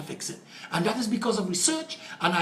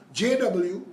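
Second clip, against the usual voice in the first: ordinary talk, nothing marked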